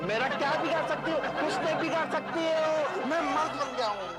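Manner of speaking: defiant bravado. Acted. Husband declaring his new manhood to his wife, defiant. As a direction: puff yourself up and dare them.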